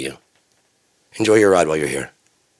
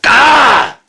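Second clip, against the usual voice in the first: high, very loud acted scream; sudden piercing "ah" at full volume; loud shriek; 12 dB louder than usual. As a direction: shriek in mock outrage. Acted shriek at doctor suggestion.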